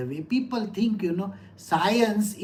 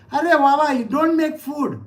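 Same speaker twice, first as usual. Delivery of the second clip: raised, higher pleading scold; half-mock scolding; 7 semitones higher, 6 dB louder than usual. To mother-in-law at the stove, a loud higher mock scold. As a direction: playfully scold her out of the kitchen.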